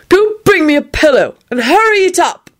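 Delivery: high-pitched defiant ordering; defiant demand. A narrator's reading. Drunken Neville orders a pillow brought, defiant. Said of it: "in a defiant voice".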